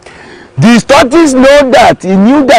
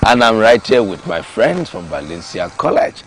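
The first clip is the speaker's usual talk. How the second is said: host announcement; 10 semitones lower, 6 dB quieter than usual. Acted. Host greeting his viewers after the break, announcer voice. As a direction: welcome the audience back. Host welcomes audience back.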